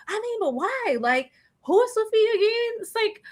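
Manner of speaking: high, exasperated disbelief; squeaky mock outrage at the question; incredulous high voice. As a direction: pitch it up in disbelief. Exasperated retelling, high far voice.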